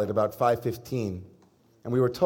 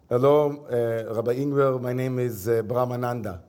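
put-on light Israeli-accented English greeting. Rabbi mimicking ashram teacher introducing himself, light Israeli accent. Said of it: "I'm someone who's good at accents".

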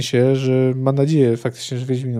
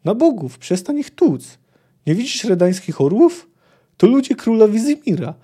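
higher, louder, alarmed pleading; panicked plea; 7 semitones higher, 4 dB louder than usual. A narrator's reading. Poet begs Geralt to stop beating the king's men, higher and panicked. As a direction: beg him to stop, rising panic.

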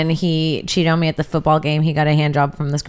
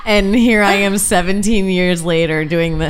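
laugh-crying; 5 semitones higher, 6 dB louder, slower than usual. Real, not acted. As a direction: voice breaking into laughter. Laughing-crying at own story.

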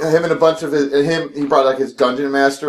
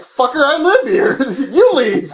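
much higher laughing retort; mock indignant; 10 semitones higher, slower than usual. Roommate telling the dungeon master off, high and laughing. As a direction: fire it back with a laugh.

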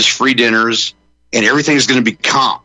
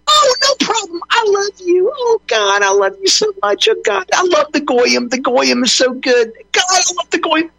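very high, gushing mock adoration; gushing girlfriend voice. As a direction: squeal it like a doting girlfriend. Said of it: "And I would go".

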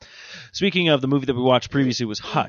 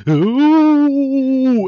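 ghostly ooh; 13 semitones higher, 9 dB louder than usual. Acted. Spooky reaction.

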